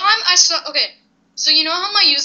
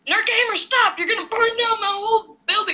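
mock panic; 8 semitones higher than usual. Mock panic at teammate's pyro.

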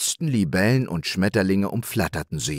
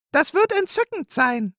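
much higher, bright magpie exclamation; delighted magpie cry. Acted. Magpie thrilled about the play, crying out. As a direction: squeal with delight. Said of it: "rief die Elster".

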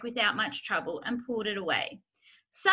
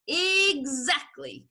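slow, loud, drawn-out word; relishing confirmation; 5 semitones higher, 5 dB louder, much slower than usual. A narrator's reading. Fox confirming his son's discovery, relishing it. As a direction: stretch it with satisfaction.

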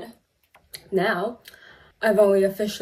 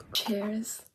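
quiet cheers; 6 dB quieter than usual. Real, not acted. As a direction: say the toast softly, at normal pitch. Same toast repeated in low voice.

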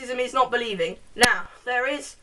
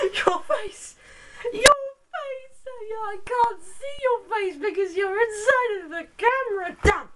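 goofy high squeal at the camera; mock fussing in a very high voice; silly high-pitched voice; 10 semitones higher, slower than usual. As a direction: play it high and ridiculous. Silly performed intro to camera.

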